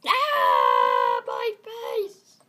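loud, high cry of mock agony; pained scream. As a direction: scream as if hit in the face. Character hit in the face.

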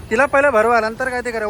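ordinary talk, nothing marked

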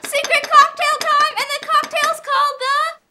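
high, loud, slow announcer build-up; big game-show reveal; hyped announcer. Acted. Grand reveal of secret cocktail.

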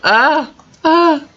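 high, loud startled cries; sharp alarmed shrieks; startled yelp. Real, not acted. Drops item in game.

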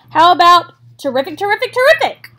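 high loud goose repeating; excited goose. Acted. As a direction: rattle it off like an honking goose.

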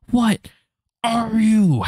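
high-pitched, drawn-out mock wail; hammed-up high cry of frustration; exasperated outburst. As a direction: wail it in exasperation at something you should know. Exasperated outburst at forgotten character.

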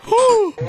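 sudden high gasp of shock; sharp gasp. Real, not acted. Spotting the imposter on screen, a startled gasp. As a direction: gasp as if caught off guard.

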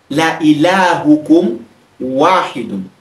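ilahukum spelled out slowly, each syllable clear; slow Arabic recital. Shown as a demonstration. Teacher modelling the Arabic sentence, slow and clear. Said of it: "we can say".

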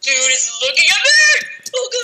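panicking gamer. Real, not acted. Creeper chasing in game.

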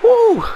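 high, loud "woo" of joy; celebratory whoop. Real, not acted. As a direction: let out a piercing victory whoop. Celebrating the win.

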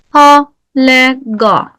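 Halega drawn out slowly in Arabic recitation; Quranic recitation. Shown as a demonstration. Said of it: "bir bakalım".

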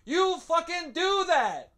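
laughing while pushing the mic away, then shouting from a distance; phone held far away. Real, not acted. Ranting at the viewers, phone pushed far away from the mouth. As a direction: voice going distant as the phone is held out at arm's length. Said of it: "I'm holding this further and further away from me".

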